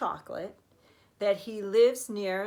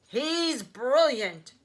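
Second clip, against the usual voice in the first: slow, louder, marvelling; delighted cry; 5 semitones higher, 7 dB louder, much slower than usual. Acted. Grandpa praising Wonka, slow delighted cry. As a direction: savour it like a thrilled old man. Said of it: "cried Grandpa Joe".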